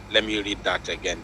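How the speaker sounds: usual speaking voice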